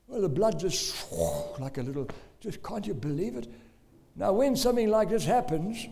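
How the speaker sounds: ordinary talk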